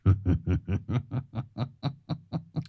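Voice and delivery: rising sinister cackle; a creeping villain laugh. Shown as a demonstration. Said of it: "điệu cười phản diện".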